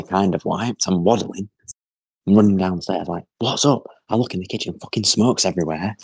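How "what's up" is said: high-pitched questioning call; mock-alarmed, pitched up; retold calling out. Son running downstairs to mum, retold high call.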